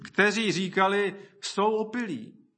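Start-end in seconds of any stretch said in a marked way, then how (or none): 1.4-2.5 higher, slow imitation of a scoffer; mocking. Scoffers at Pentecost talking about believers, mildly mocking. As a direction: lightly mimic a drawn-out jeer. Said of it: "byli prostě posměvači".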